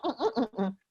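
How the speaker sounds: ordinary delivery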